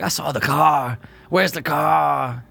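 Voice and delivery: slow mock Loomis. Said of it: "Because he's like".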